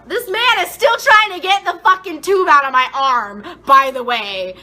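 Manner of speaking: high, loud indignant rant; loud, fed-up complaint; exasperated outburst. Real, not acted. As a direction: burst out in exasperation at his slowness. Exasperated rant about slow worker.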